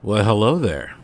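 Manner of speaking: ordinary talk, nothing marked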